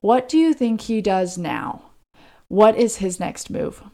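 ordinary delivery

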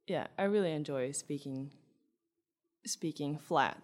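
ordinary talk

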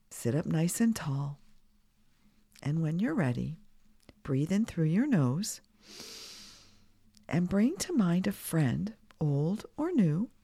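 guided meditation. Acted. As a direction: guide kids calmly through breaths. Leads breathing exercise for kids.

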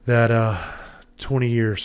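breathless, gasping between words; talking short of breath. Real, not acted. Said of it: "Sorry if I'm a little short of breath".

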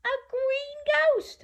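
very high-pitched exclamation; gasp-worthy pretend shock; mock astonishment. Storyteller to children about the ghost, high mock astonishment.

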